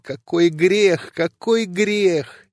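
slow, mournful repetition; slow lament. Acted. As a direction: stretch each word as if in anguish. Repeated lament.

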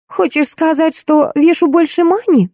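high, wounded, offended question; taking offence. Acted. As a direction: pounce on an excuse to feel hurt. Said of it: "нашла повод обидеться Ольга".

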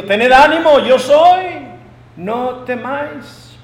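high, loud call to frightened men; calling out reassurance. Acted. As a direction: call out to steady them, slowing at the end. Voices Jesus calling to frightened disciples. (llamado fuerte de ánimo)

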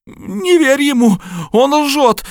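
high, loud, slow shriek. A narrator's reading. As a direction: shriek it out in panic. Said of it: "визжала Хелена".

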